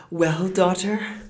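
high, loud, slow calling out; eager cry. A narrator's reading. Mother calling to her returning daughter, loud and eager. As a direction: call out eagerly, stretching the words. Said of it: "she cried out".

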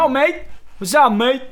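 high, loud Aussie greeting; hammed-up Australian accent. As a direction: greet a mate in broad Australian. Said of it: "el acento australiano".

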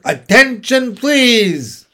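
loud, high public-address call; loudspeaker announcement. A narrator's reading. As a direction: call it out over the fairground loudspeaker. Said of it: "a voice was heard on the loudspeaker".

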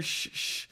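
sharp unvoiced shush; hushing "shh, shh"; retold shushing. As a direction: imitate people shushing someone. People shushing the loud Wildman.

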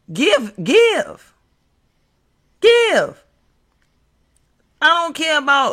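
building to a high shout; shouting give. Real, not acted.

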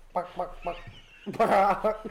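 high clucking chicken imitation. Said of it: "They go".

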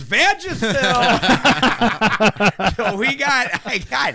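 high, loud laughing reveal. Real, not acted. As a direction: crack up at the reveal. Laughing reveal of gag gift.

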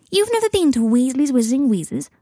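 put-on high, incredulous child's question; disbelief. A narrator's reading. Teddy asking Scorpius about the shop, in high disbelief. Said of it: "asked Teddy in disbelief".

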